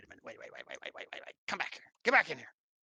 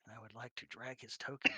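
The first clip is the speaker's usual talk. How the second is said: ordinary talk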